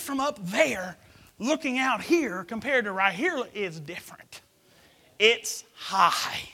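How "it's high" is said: exclaimed. Real, not acted. Exclaimed fear of heights.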